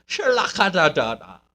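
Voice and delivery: very high, slow, swooning Southern lady; Southern American accent. Retold or imitated. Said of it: "Southern American accent?".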